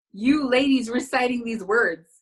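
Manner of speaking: ordinary talk, nothing marked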